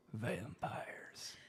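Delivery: hushed, ominous mock whisper; spooky whispered reveal. [name] reveals what got Danny, ominous whisper. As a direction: breathe the word out like a campfire scare.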